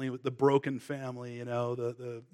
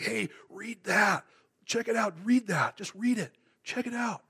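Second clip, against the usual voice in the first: eager friend; 5 semitones higher than usual. Retold or imitated. Surfer friend urging Chris to read the Bible, eager and higher. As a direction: push the Bible at him with excitement.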